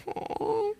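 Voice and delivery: tiny high-pitched melting coo; soft, squeaky sigh of being touched. Acted. Acts out being touched by compliment.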